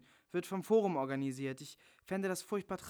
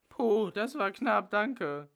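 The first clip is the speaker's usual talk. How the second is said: relieved; 5 semitones higher, 5 dB louder, much slower than usual. Acted. Relief after swallowing pills.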